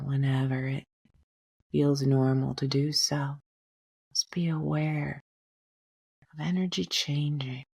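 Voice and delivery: gently guiding a breath meditation, unhurried; guided meditation. Acted. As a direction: ease the listener into calm breathing. Leads a meditation.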